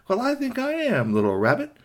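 high, triumphant fox retort; gloating refusal. Acted. Fox overruling rabbit's plea, gloating. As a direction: start high, drop smugly at the end.

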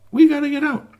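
high, loud squeal to get out; desperate shout. Acted. Trapped doll squealing high and loud to get out. Dolls pleading to escape box.

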